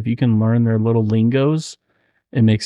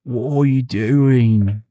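slow, put-on Scottish accent; much slower than usual. Friend trying accents, slow Scottish accent. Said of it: "What did she sound like?".

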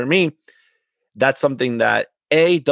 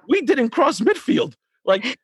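higher, insistent retold protest; heated protest; 11 semitones higher than usual. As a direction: argue your case with the referee. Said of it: "I was like".

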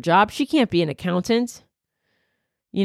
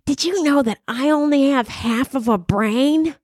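Miss Neely's voice; 5 semitones higher than usual. Retold or imitated. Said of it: "That's how she sounded".

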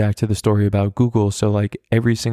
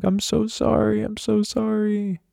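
high, softer, tearful mock apology; imitating sobbing; 12 semitones higher, 4 dB quieter than usual. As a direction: whimper it like a sobbing child begging forgiveness. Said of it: "So I start crying".

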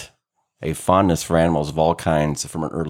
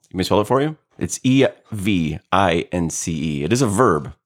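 ordinary talk, nothing marked

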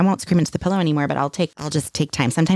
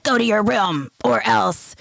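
slow, higher-pitched mock parental threat; stern threat; 4 semitones higher, slower than usual. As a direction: play the threatening parent, drawing each word out. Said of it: "I never do it like".

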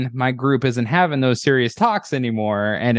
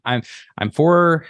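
ordinary talk

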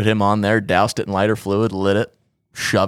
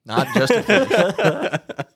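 very slow, high, laughing protest; 10 semitones higher, much slower than usual. Real, not acted. Teller defends his dead fish Stan, slow laughing protest. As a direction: stretch each word out through a laugh.

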